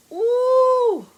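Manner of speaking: a high, drawn-out ooh of delight; excited ooh. Real, not acted. Cook eyeing her dish, excited ooh. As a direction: let it rise in pure anticipation. Anticipating the food.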